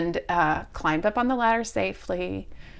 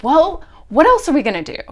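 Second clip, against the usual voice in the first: higher, louder and fast; loud exasperated comeback; 7 semitones higher, 8 dB louder, faster than usual. Retold or imitated. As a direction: throw up your hands, pleading. Exasperated reply in the argument.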